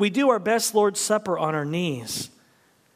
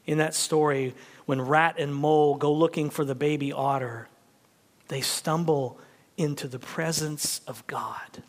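ordinary talk, nothing marked

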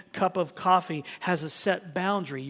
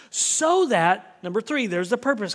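emphatic; 5 semitones higher, 6 dB louder than usual. Real, not acted. Preacher building to climax.

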